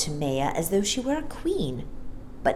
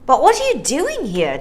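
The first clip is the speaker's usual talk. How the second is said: surprised; 8 semitones higher, 5 dB louder than usual. Acted. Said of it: "still very surprised".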